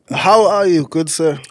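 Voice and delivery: normal talking voice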